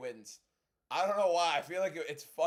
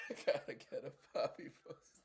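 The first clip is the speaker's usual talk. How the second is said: breathless wheeze through laughter; laughing so hard the voice goes; wheezing laugh; 2 semitones lower, 6 dB quieter than usual. Real, not acted. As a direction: airless giggling repeat. Repeats line while laughing.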